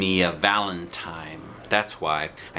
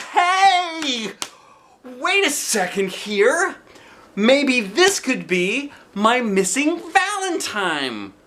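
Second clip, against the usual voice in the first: put-on much higher, louder and slow child squeal; thrilled; 4 dB louder, much slower than usual. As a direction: squeal it out, overjoyed. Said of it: "very thrilled".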